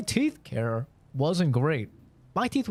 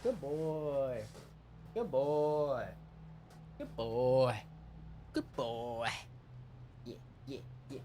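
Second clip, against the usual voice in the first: dog praise; 10 dB quieter, slower than usual. Real, not acted.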